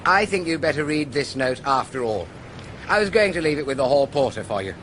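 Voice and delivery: ordinary delivery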